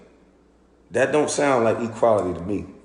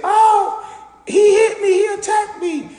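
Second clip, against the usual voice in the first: high, loud shrill falsetto accusation; mock woman yelling he hit me; mocking girl; 7 dB louder than usual. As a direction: ham up her fake victim cry.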